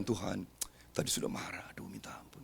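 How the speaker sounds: breathy whispered plea for forgiveness; whispered prayer. Pretend. Pastor voicing a guilty believer's night prayer, whispered.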